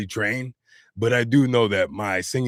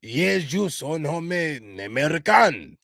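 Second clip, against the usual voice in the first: slow, higher-pitched mock-French accent; drawn-out French accent, pitched up; attempted French accent; 6 semitones higher, slower than usual. Shown as a demonstration. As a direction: ham up a clumsy French accent, stretched out. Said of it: "Oh, I'm shaming myself".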